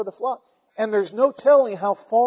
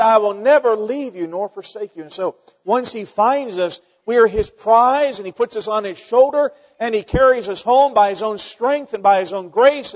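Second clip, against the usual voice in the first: rising, louder pulpit delivery; full-force preaching; 3 semitones higher, 5 dB louder than usual. Acted. Preacher lifting the congregation, building fervent preaching. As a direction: build the sermon to its peak.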